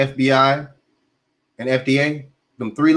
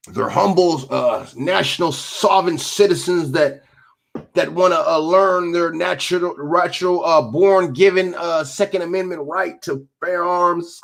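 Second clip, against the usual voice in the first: sarcastic mock reverence; 5 semitones higher than usual. Sarcastic mock of gun owners.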